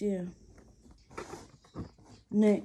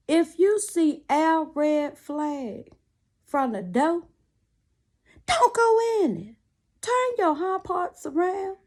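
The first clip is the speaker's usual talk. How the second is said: raised, slow, sermon-like warning; preaching; 6 semitones higher, 3 dB louder, slower than usual. Real, not acted. Preaching to young ladies about red flags, raised and slow. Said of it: "I sound like a southern priest".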